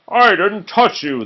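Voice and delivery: high, loud indignant protest. Acted. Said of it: "the second ogre protested".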